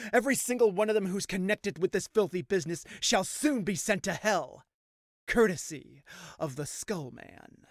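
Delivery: loud menacing villain declaration, slowing at the end; villain's vow. Acted. Skullman vowing revenge on his enemies, loud menace. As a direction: proclaim doom, then draw out the sign-off.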